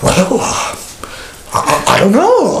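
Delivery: high, halting, flustered stammer; caught-out churchgoer. Retold or imitated. A member asked about discipline stammers nervously. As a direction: play the caught member fumbling for an answer. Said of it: "most of the time people go".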